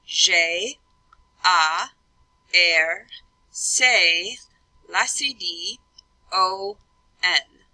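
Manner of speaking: French letter names, each punched out separately; deliberate letter-by-letter French dictation; French spelling aloud. Shown as a demonstration. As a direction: spell "J, A, R, C, La, C, D, O, N" in French, pausing between. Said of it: "So I'd start off with".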